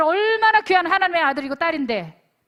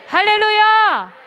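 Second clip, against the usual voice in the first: slow, loud, higher hallelujah shout; preaching shout; 6 semitones higher, 11 dB louder, slower than usual. Real, not acted. Preacher rallying the youth retreat, a full shout. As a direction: cry it out at full force.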